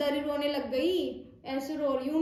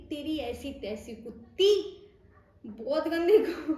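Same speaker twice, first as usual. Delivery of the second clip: laughing curse; 2 semitones higher, 5 dB louder than usual. Real, not acted. Angry at nurse, laughing.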